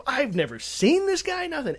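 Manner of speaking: can't believe none of it rings a bell; baffled. Retold or imitated. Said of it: "I'm like".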